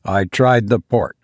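ordinary delivery

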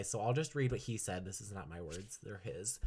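ordinary talk

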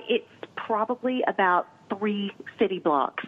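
ordinary talk

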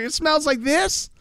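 high, slow put-on voice; Chloe. Host retelling contestant blaming the smell, slow high-pitched impersonation. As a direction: mimic the contestant, drawn out and high. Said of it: "She's like".

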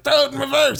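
high-pitched, hammed-up shouted order; mock urgent command. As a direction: imitate yelling to the driver. Ernest yelling to the driving dog.

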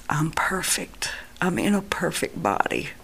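soft, breath-light marvelling; near-whispered wonder, retold; hushed amazement. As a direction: quietly marvel at your own body. Retold marvelling at his healed body.

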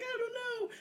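put-on high helpless little-boy voice; helpless Hansel. Hansel answering his sister, high and helpless. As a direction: whimper it like a lost kid.

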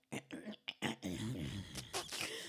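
low, raspy labored breath noises; imitated sad breathing. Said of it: "it was just a sad breath".

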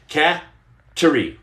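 CAT and TERRY as two separate beats; broken into syllables. Shown as a demonstration. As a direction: sound out "Cat-, Terry" haltingly, hitting each part. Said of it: "this is gonna be hard".

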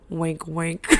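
low, hushed mock-confidential aside; soft, low tongue-in-cheek murmur; sly aside. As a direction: drop it low and soft, knowingly. Joking aside after denying drinking.

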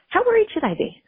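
worried high question. Real, not acted.

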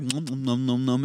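quick soft nom-nom chewing; imitated munching noise. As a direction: mimic someone gobbling cheese. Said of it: "He's just like".

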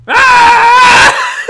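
shrieking high mock scream, laughing; imitated child scream. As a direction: scream high and loud like the kid in the road. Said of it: "he then starts screaming at me".

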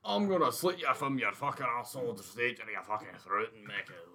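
fast, rough mock-Scots threat; reciting a gangster line in a put-on brogue; mock Scottish threat. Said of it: "it's one of those things, like".

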